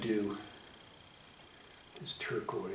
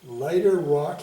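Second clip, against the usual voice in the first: mock grand announcement; 4 semitones higher, 10 dB louder than usual. Painter mocking his own instruction to viewers, mock-pompous loud call. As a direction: declare it loud and lofty, like a proclamation. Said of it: "he said".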